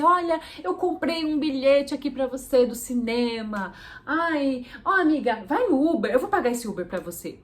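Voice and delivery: a friend gushing with a surprise gift; excited friend. Retold or imitated. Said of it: "um amigo do nada liga pra você". (amiga empolgada, voz mais aguda)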